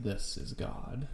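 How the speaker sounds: slow, breathless whisper; creepy whisper. Retold or imitated. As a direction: whisper it slowly like a taunting killer. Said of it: "he pulls up his glove and he's like".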